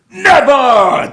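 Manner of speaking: theatrical defiant yell; defiant shout. Retold or imitated. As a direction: yell it like a hammed-up movie hero. Acted defiant movie reply.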